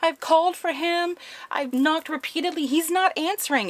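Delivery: lightly voiced frightened neighbour; scared. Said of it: "and she's scared".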